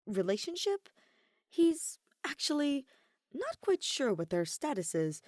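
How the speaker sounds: ordinary delivery, nothing marked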